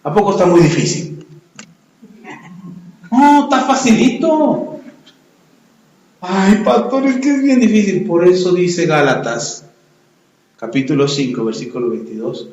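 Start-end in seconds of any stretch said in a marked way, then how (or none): 3.1-4.8 sarcastic. Acted. Pastor mocks easy answer. 6.2-8.1 whiny congregant. Acted. Congregant voiced complaining to pastor.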